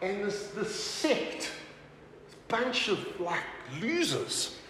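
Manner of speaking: drawn-out put-on contempt; acted contempt. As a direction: imitate a critic sneering quietly. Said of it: "historical documents that criticize".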